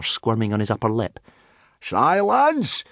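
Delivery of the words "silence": high, stretched shout; drawn-out yelled order, pitch soaring; screamed command. A narrator's reading. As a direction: scream for silence. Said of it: "screamed Gobber".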